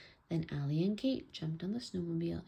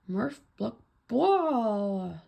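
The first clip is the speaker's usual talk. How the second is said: higher mock garble swelling loud; mouth full of snow, acted; 4 semitones higher, 8 dB louder, much slower than usual. A narrator's reading. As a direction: blurt nonsense as if through snow, stretching the last word. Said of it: "his mouth was full of snow".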